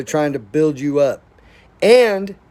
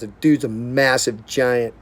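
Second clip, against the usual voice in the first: ordinary talk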